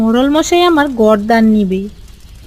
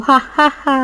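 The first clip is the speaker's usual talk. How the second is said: villain laugh. Acted. Gloating over the plan.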